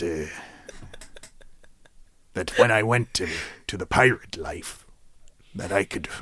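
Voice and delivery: low, quiet, hesitant confession; sad confession. Acted. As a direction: confess it softly and sheepishly.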